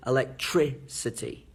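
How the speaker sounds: "electricity" broken up, heavy beat on "tri"; strong syllable stressed. Shown as a demonstration. Teacher drilling word stress, "electricity" punched on "tri". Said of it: "they get used to stressing different words".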